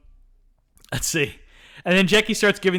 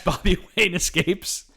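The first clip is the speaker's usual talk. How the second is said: ordinary talk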